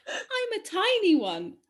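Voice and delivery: slow, sing-song riddle reading; drawn-out words, swooping up on the key ones; riddle recital. Acted. Quizmaster repeating a clue to puzzled friends, riddle recital.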